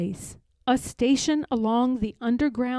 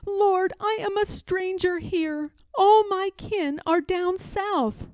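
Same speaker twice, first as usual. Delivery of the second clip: higher, sorrowful plea; Harriet lamenting; 8 semitones higher, 3 dB louder than usual. Acted. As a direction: tell the Lord of her loneliness.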